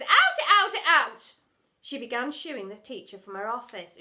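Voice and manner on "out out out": very loud high shout. Acted. Headmistress shooing the teacher out, very loud. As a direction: drive her out.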